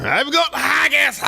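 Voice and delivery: high, drawn-out Scottish yell; mock Scottish accent. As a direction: holler it like a cartoon Scot. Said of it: "there was a Scot in the back".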